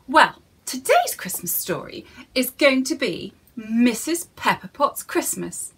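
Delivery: ordinary delivery, nothing marked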